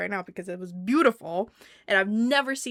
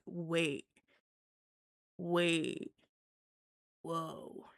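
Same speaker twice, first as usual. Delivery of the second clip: soft, low, stunned murmur; acted quiet amazement; dawning awe; 4 semitones lower, 8 dB quieter, much slower than usual. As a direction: say it hushed, pausing as it sinks in. Acts out Shakespeare dawning on you.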